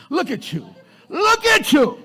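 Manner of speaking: high, loud taunt building to a shout; accusing shout. Acted. Accusers pointing the finger at her, accusing shout. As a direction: jump up into a mocking yell.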